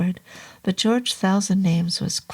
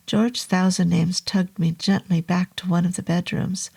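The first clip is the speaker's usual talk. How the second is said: ordinary delivery, nothing marked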